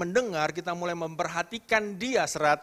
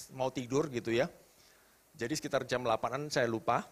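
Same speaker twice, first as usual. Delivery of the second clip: ordinary delivery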